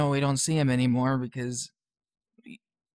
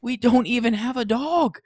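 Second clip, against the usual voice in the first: put-on exasperated kid; 7 semitones higher, 4 dB louder than usual.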